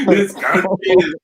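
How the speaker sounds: laughing through the words; disbelieving laugh. Real, not acted. Vlogger laughing at the doomed line, amused.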